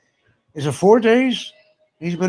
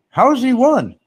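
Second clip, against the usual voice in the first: incredulous; 13 semitones higher, 6 dB louder than usual. Real, not acted. Incredulous question.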